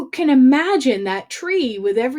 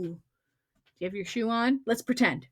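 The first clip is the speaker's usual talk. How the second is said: usual speaking voice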